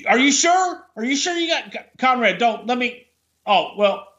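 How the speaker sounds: high, flustered half-hearted protest; mock offer to pay. Guest faking a reach for the dinner tab, mock protesting. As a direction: put on a halting, high-pitched show of reaching for the bill.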